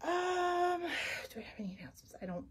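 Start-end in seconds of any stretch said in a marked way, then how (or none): 1.3-2.5 whispered aside to herself; softly whispered question. Real, not acted. Aside to self, unvoiced.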